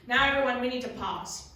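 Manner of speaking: ordinary delivery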